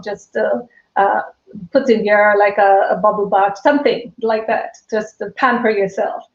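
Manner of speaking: ordinary delivery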